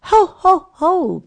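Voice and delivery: booming high ho ho ho; jolly Santa cry. Acted. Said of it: "cried Santa".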